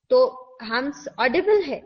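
ordinary talk, nothing marked

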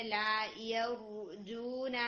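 لا یرجون slowly with a thick ra; heavy ra. Shown as a demonstration. Teacher reading یرجون with a heavy ra. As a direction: make the ra of یرجون heavy and slow. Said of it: "heavy کر کے پڑھیں گے".

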